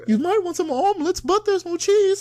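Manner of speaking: very high, loud British squawk; mock British accent. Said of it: "doing a fucking British accent".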